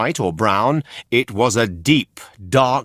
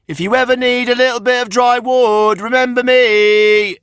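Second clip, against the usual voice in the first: very high, louder called goodbye; calling out; 13 semitones higher, 6 dB louder, faster than usual. A narrator's reading. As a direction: call it out across the water. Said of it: "he called".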